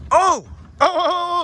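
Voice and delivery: loud high excited yelps; excited shouting. Real, not acted. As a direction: cheer the jump, voice up high. Reacting to a big jump.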